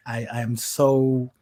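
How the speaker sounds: ordinary talk, nothing marked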